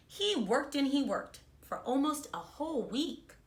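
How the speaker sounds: put-on reading a storybook to children; bright, expressive read-aloud; kindergarten teacher voice. A narrator's reading. Teacher reads picture book aloud.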